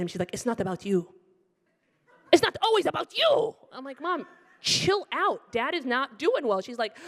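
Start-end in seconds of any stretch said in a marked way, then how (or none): 2.3-3.5 high, loud mock screech; hammed-up shrill scolding; mom snapping. Mom's scolding escalates. 4.0-6.6 slow, high-pitched telling-off; acted exasperation, voice raised; exasperated daughter. Acted quarrel with mom.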